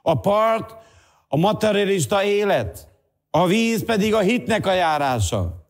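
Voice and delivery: insistent preacher, voice held high; impassioned preaching. Real, not acted. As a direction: expound the verse with fervour. Preaches at full force.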